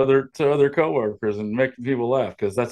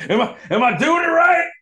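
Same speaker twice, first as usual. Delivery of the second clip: hammed-up boss yell; mock shout. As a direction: yell it like the over-the-top boss. Joining the shouting impression.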